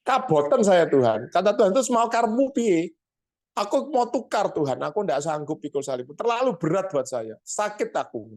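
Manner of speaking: high, pleading whine; man begs God for a lighter cross, whining; whiny complaining man. Acted. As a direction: beg God to swap the cross. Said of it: "ngomongin Jawa nih".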